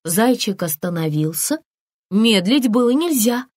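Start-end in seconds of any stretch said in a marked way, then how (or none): none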